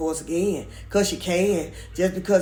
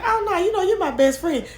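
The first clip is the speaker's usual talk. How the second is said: mock gushing; 10 semitones higher than usual. Mimicking her gushing reply.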